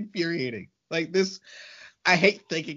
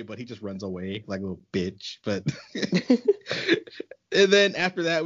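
usual speaking voice

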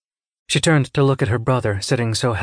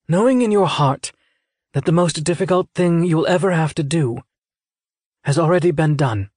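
higher, intent character voice; earnest scythe mentor; 7 semitones higher than usual. A narrator's reading. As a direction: urge the candidate on with solemn insistence.

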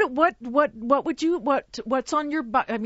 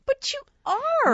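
tearful reassurance; 13 semitones higher, slower than usual. Real, not acted.

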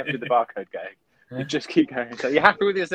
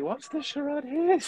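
much higher and slow, baffled; mock puzzled; 13 semitones higher, 3 dB quieter, slower than usual. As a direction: drag it out like a confused spectator. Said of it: "It's like".

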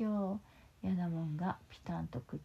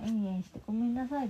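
mild mock yell; 4 semitones higher, 6 dB louder than usual. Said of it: "大きな声で叫んだよ".

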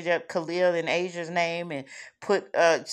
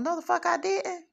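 high, sharp comeback, much higher pitch; indignant retort; 12 semitones higher than usual. Real, not acted. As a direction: snap back in disbelief. Retorting to a critic.

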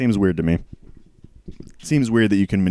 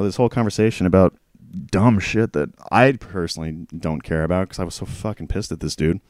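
ordinary delivery

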